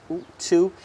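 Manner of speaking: ordinary talk, nothing marked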